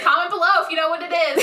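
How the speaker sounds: quick, bright YouTuber outro voice; YouTuber sign-off. Pretend. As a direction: rattle it off like a vlogger. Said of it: "I like how I say comment below".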